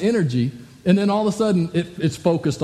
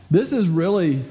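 ordinary delivery

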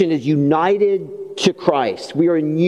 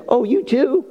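teasing wife; 10 semitones higher than usual. Retold or imitated. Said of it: "she said".

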